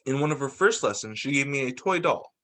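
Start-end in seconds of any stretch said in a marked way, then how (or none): none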